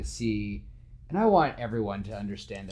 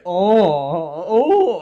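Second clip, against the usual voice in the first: much higher, loud mock-impressed oh oh; teasing; 8 semitones higher, 14 dB louder than usual. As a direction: tease him like a friend would. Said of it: "teasing each other".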